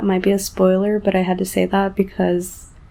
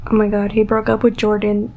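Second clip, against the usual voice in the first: ordinary talk, nothing marked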